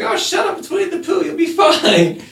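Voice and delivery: high, brushing-off teacher voice; dismissive teacher. Retold or imitated. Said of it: "She's like".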